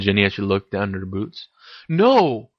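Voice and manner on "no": quick protest, higher and louder; exclaimed. A narrator's reading. Harry protests to Ginny, exclaiming. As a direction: exclaim it in sudden denial. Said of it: "exclaimed Harry".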